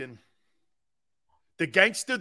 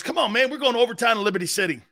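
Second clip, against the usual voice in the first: fast quote; 4 semitones higher, 3 dB louder, much faster than usual. Retold or imitated. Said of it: "He goes".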